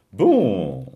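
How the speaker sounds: loud, drawn-out, swooping exclamation; emphatic agreement. Acted. Narrator answering Lucienne's change of mind, emphatic agreement. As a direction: stretch it out loudly in acceptance. Relieved, triumphant reply to Lucienne.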